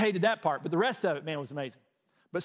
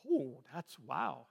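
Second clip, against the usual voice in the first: slow, hushed, lower and softer, stunned; awed hush; 5 semitones lower, 8 dB quieter, much slower than usual. Retold or imitated. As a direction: let the wonder leave you almost speechless. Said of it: "I was like".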